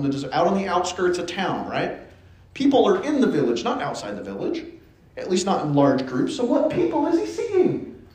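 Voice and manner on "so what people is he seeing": pitched up, asking the room; emphatic puzzled question. Real, not acted. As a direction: pose it high, as a real puzzle. Puzzling question put to the congregation.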